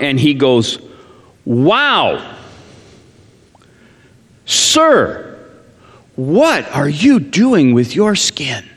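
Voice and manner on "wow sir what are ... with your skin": mock gushing salesman, sir jumps high; preacher voicing a mall salesman stopping him, gushing; imitating a salesman. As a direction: ham up a pushy mall clerk's flattery. Mall salesman's gushing pitch retold.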